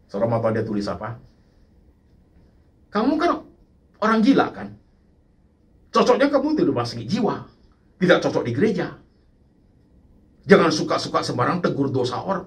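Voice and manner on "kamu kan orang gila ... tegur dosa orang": raised, scolding mock accusation; angry voice. Preacher voicing the angry texter's accusation, scolding. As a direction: read the message as an angry accuser.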